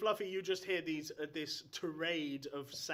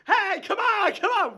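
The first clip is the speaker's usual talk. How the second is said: loud, high shout over the crowd; retold shout; 17 dB louder than usual. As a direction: bellow it while cracking the whip. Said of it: "you just come in going".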